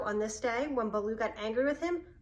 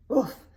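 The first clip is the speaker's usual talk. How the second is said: bear woof; 2 semitones higher, 6 dB louder than usual. A narrator's reading. Bear growls at boy.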